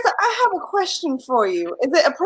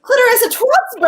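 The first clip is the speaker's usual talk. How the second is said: surprised; 7 semitones higher, 4 dB louder than usual. Real, not acted. Host reacts to fake name.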